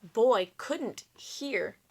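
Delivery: a heavy storyteller pause; slow emphatic reading. A narrator's reading. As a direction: lean on each word slowly. Dramatic read-aloud line.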